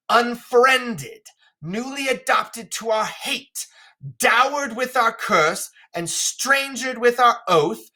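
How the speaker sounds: high, slow theatrical declaiming; stagey, high-pitched royal contempt; scornful King Lear. Acted. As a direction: draw out each cruel charge as an imperious king. Reads Lear's lines aloud.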